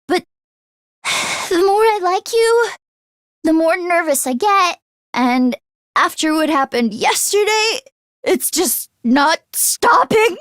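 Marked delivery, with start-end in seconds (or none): none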